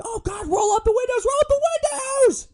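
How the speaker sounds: shrieking way up high and loud in panic; begging screams. Retold or imitated. Freezing passengers begging the driver, panicked shrieking. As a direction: squeal like terrified kids in a freezing car. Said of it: "they're begging me".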